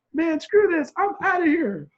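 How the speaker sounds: high, loud, fed-up exclamation; defiant outburst. Retold or imitated. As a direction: burst out, determined to leave. Acts out his defiant escape attempt.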